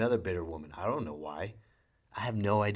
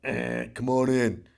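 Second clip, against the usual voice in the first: slow, louder bouncer invite; a hint of an underground club host; club doorman; 3 semitones higher, 6 dB louder, much slower than usual. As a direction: draw it out like a velvet-rope bouncer. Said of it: "as if you're going to an underground club".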